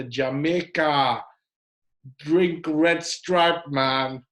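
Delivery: a deliberately bad Jamaican accent for a joke. Host greeting a caller from Jamaica, in a bad Jamaican accent. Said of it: "that was a bad Jamaican accent".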